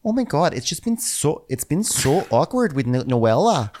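Nicole. Retold or imitated. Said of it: "she has a cute little Midwestern accent".

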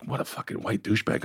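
hushed, barely voiced thought; muttered aside. Retold thought to fellow inmates, hushed aside. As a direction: say it under your breath.

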